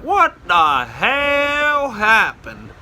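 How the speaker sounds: high, drawn-out cop reaction; mock bewilderment. Arriving cops to their stranded colleague, drawled astonishment. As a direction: stretch it out in hammed-up disbelief.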